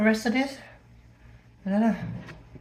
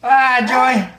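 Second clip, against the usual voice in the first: loud call; 8 dB louder, slower than usual. Real, not acted. Calling out to viewers.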